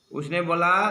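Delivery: usual speaking voice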